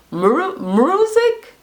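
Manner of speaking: "music" mangled with an extra r, high and questioning; mocked mispronunciation. Host mocking his speech, "music" slurred like "mruzic". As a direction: drag out "music" as a garbled, incredulous high question. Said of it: "He just talk wrong".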